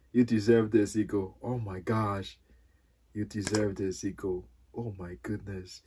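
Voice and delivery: low, soft, heartfelt praise; awed sincerity. Real, not acted. Gamer rewarding the card that won the match, awed sincerity. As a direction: murmur it in grateful awe.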